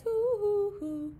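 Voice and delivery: put-on sing-song hoo hoo for children; owl hoots. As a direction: hoot like a cartoon owl for kids. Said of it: "The owl in the tree says".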